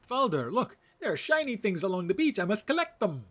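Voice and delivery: high, loud, eager ratonga voice; high-pitched creature, bursting with excitement; excitable little rat creature. Acted. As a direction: squeal it like an excited small critter.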